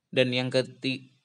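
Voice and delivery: normal talking voice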